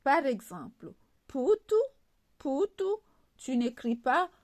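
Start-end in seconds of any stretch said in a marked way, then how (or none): 1.3-2.8 "putu" with a rounded ou, poo-too. Shown as a demonstration. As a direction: model "putu" high and clear with the ou sound. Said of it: "la lettre U se prononce ou". (« putu » prononcé avec le son ou)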